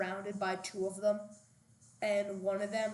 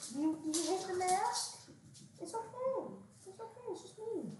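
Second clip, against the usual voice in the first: high, soft soothing pet voice; 9 semitones higher, 4 dB quieter than usual. Real, not acted. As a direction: reassure a scared puppy, high and gentle. Soothing dog scared of mask.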